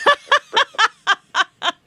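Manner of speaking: high, loud burst of laughter; big laugh. Real, not acted. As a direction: crack up at the absurd gift. Laughing at breakup gift.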